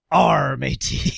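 a hammed-up pirate by mistake. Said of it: "doing the pirate thing".

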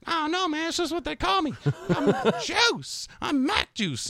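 put-on high-pitched kid voice with a laugh; imitating the kid. Teacher mimics student explaining his nickname, high kid voice. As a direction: squeak it up like a carefree student. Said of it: "He's like".

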